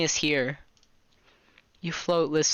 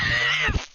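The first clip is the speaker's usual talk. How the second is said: laughing burst; 5 semitones lower than usual. Real, not acted. Laughing shout at game.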